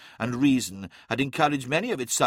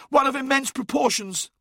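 high-pitched, shocked exclamation; gasped out; 14 semitones higher, 3 dB louder than usual. A narrator's reading. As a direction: push it out high, still reeling. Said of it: "gasped Uthizar".

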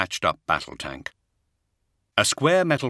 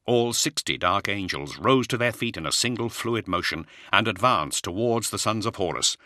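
ordinary delivery, nothing marked

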